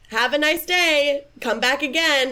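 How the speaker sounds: mock-cheery shopkeeper goodbye; pointed farewell. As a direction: hurry an unwanted customer out. Shopkeeper dismisses customer using thaumaturgy.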